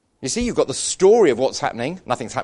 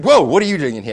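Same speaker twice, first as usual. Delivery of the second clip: louder startled whoa; startled man; 4 semitones higher, 7 dB louder, faster than usual. Retold or imitated. Tunnel control man surprised at an intruder, louder and startled. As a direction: catch him off guard.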